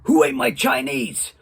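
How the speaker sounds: first stab at an angry character voice, drawn out; irate. Acted. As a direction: demand it slowly, mock-irate. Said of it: "irate, and he was like".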